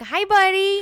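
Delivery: put-on high, loud doggy baby talk. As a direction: coo at a puppy. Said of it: "this is for your dog".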